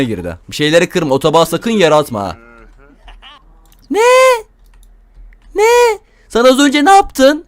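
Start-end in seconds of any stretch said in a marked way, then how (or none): 3.9-7.4 high, loud disbelieving cry; can't believe what he just saw; shocked. Real, not acted. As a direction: pitch jumps up in shock. Shocked at in-law flirting.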